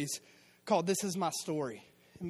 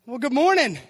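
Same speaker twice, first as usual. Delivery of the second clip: greeting; 6 semitones higher, 13 dB louder than usual. Shown as a demonstration. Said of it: "Let's try that again".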